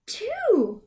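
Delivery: high delighted count; excited count. Real, not acted. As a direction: announce the count with joy. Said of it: "how many syllables".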